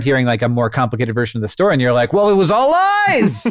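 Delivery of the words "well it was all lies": mock dramatic. Mocking the disillusioned grown-up view.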